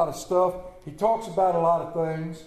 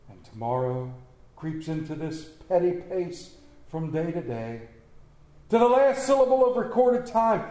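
dramatic Shakespeare recitation; 3 semitones lower than usual. Acted. Preacher reciting Macbeth to the congregation, dramatic recitation. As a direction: recite with weighty pauses, build, then fade away.

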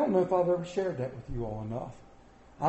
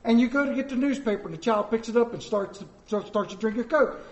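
usual speaking voice

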